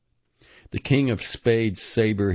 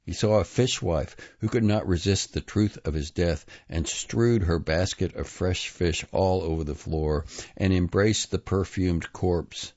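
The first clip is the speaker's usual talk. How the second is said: plain reading voice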